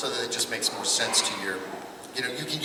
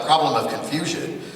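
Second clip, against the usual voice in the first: normal talking voice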